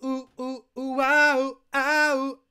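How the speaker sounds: high, wincing ooh-ow cries; pained ooh and ow. Real, not acted. Streamer winces aloud at the bad draft, high pained cries. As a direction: groan as if the draft physically hurts.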